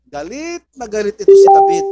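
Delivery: high, loud burst of anger; preacher erupting with David's rage; furious outburst. Acted. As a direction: shout it as if enraged yourself. Preacher stresses David's fury.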